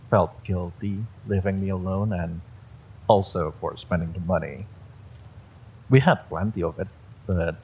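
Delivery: ordinary delivery, nothing marked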